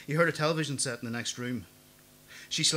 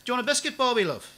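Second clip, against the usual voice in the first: put-on high, loud old lady calling from the kitchen; old woman shouting an offer from the kitchen, high and loud; shrill old woman; 12 semitones higher, 8 dB louder than usual. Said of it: "The old woman's voice shrilled".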